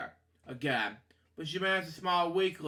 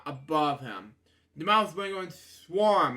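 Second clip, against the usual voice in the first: ordinary delivery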